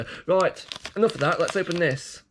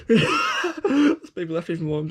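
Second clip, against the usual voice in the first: slow words broken by real laughter; laughing through words; 3 semitones higher, slower than usual. Real, not acted. To the viewers, laughing through the words. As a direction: try to talk but laugh through it. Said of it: "if I can stop laughing".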